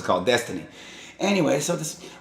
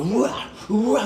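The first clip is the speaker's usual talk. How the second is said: mock battle cry; 12 semitones higher than usual. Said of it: "looks like the ultimate warrior".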